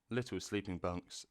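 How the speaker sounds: ordinary delivery, nothing marked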